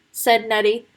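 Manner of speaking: ordinary delivery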